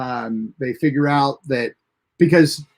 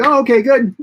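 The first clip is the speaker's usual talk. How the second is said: relieved Janeway; 9 semitones higher, 5 dB louder than usual. Retold or imitated. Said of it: "she's like".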